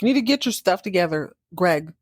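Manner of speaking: fast, impatient scolding; bossy shooing. Retold or imitated. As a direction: hurry him off. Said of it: "She's like".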